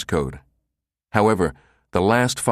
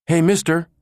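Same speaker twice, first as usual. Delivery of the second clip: higher, louder call across concourse; 10 semitones higher, 4 dB louder than usual. Acted. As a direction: call after him. Girl calls after Becker.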